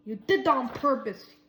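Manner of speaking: loud, furious accusation; roar. A narrator's reading. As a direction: roar it at the clumsy stranger. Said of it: "he roared".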